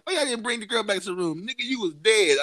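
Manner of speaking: mock argument. Acted argument with friends over girl.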